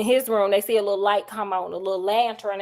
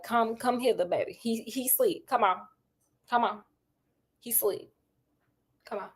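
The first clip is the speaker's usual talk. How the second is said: hushed come-hither call; 8 dB quieter than usual. Retold or imitated. Girl signals Watson to sneak in.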